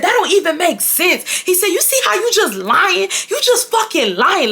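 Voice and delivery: angry ex calling her a liar, mocked high; imitating furious ex. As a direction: squeal his accusation back like a mock tantrum. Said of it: "that's how he's talking to me".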